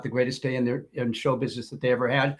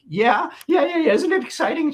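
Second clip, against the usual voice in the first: high, louder giddy excitement; giddy waitress; 12 semitones higher, 4 dB louder than usual. Retold or imitated. Waitress gushing to her manager about Morrison, high and giddy. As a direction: gush like a starstruck fan. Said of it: "She's like".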